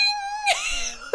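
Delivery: high shrieking real laugh; burst of laughter. Real, not acted. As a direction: explode into laughter. Bell rings in dramatic pause.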